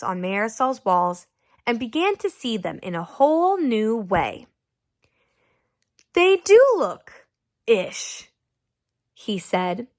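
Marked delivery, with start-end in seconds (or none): 6.1-8.3 slow, higher, awed realization; dawning wonder. Acted. Raymond seeing the drawings anew, slow awe. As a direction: let the idea dawn slowly.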